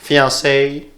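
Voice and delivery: Fiancé with English vowels; English accent. Pretend. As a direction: say Fiancé like an anglophone. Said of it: "Il y a même l'accent".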